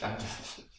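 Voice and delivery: normal talking voice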